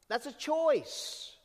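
high, slow, every word weighted; slow emphasis. Real, not acted. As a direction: stretch it out to land it. Preacher stressing point.

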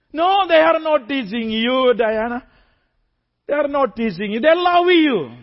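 lightly voiced teacher insisting they love her; teacher reassuring.

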